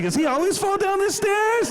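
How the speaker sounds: high-pitched questioning voice; puzzled guest. Retold or imitated. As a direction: ask it up high, like a baffled houseguest. Guests puzzled in a retold scene.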